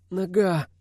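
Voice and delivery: high groan of pain; pained moan. A narrator's reading. Wounded Kazakh names his injury, high moan. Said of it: "простонал казах".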